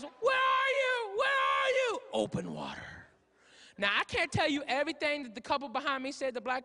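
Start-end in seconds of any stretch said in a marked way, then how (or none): 0.0-2.0 high, drawn-out acted shout. 2.1-3.1 very low, whispery announcer; trailer title voice. Acted. Intones the movie title.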